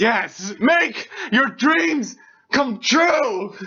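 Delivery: high, loud, slow rallying cry; motivational shout. Pretend. Reader cheers on Julia's dad, motivational shout. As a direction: shout it word by word, stretched and rousing.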